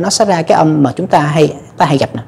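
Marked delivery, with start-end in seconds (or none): none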